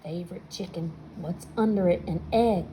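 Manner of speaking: plain reading voice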